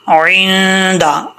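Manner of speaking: इंदा opening on a throaty ain, long hummed n; clear ain. Shown as a demonstration. Teacher says make the ain clear, slow recitation. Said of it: "आइन को clear करना है".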